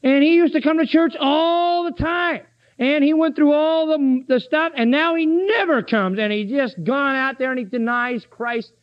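doubting churchgoer. Retold or imitated. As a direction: play the doubter in the pew fretting about Uncle Fred. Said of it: "You say".

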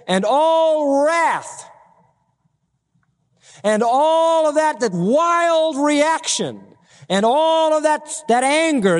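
high, slow, stretched vowels; impassioned preaching. Real, not acted. Preacher listing sins to congregation, vehement and drawn out. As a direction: hammer each vice, drawing out the key words.

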